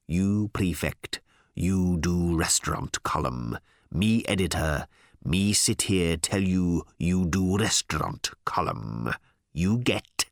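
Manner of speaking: slow, low pidgin for a dimwit; patronising, deliberate baby-simple explanation; condescending boss. Acted. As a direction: spell it out one slow chunk at a time. Harl talks down to Ford.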